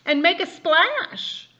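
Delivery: high, loud, slow otter voice. A narrator's reading. Father reading to child, high slow otter voice. Otter line read aloud.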